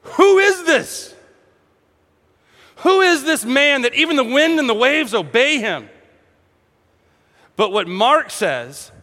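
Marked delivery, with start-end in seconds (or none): 0.0-5.9 high, alarmed cry of amazement; acted stunned outcry, pitched high; awestruck fear. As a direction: exclaim in frightened awe. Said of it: "they had a fear upon fear".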